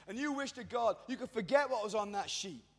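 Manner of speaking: normal talking voice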